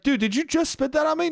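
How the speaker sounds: high, rising imitation of disbelief; mock incredulous friend. Friend questions the spitter, high mock disbelief. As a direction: ask it high, as the amused victim.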